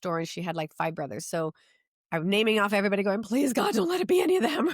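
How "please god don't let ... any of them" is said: high, loud frantic pleading; desperate plea. Retold or imitated. Naming neighbors to the practitioner, begging God in a high loud panicked plea. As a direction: beg God it isn't one of them.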